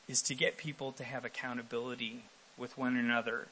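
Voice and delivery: ordinary talk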